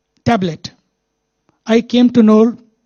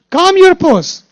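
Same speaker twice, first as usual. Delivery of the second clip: much higher and louder, driving the phrase home; loud emphatic command; 8 semitones higher, 6 dB louder, slower than usual. Shown as a demonstration. Teacher to agitated listeners, loud emphatic command. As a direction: call it out like a rallying cry.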